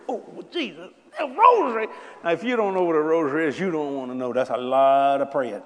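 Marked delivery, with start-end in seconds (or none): 0.1-1.9 rising to a loud, high cry of dismay; mock dismay. Young confessor dismayed at being given a rosary, loud high cry. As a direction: groan in horror at the penance.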